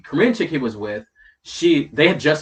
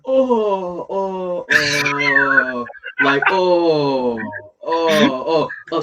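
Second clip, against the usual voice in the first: drawn-out, raised "oh" of someone caught out; hammed-up embarrassed shock; mock dismay; 2 semitones higher, 3 dB louder, much slower than usual. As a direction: imitate a man realizing his blunder, long moaning ohs. Voices the Korean guy caught out.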